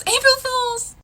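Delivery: loud, very high mock-mom shout; gleeful prank reveal. Mom revealing her prank to sobbing child, high shout.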